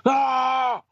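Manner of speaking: muffled bubble scream. Pretend. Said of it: "his sounds go out with his bubbles".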